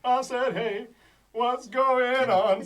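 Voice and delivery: high, stretched-out singsong boom; booming cosmic voice. Acted. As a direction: draw it out like a comic voice from the heavens. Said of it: "Sort of this booming voice from the cosmos".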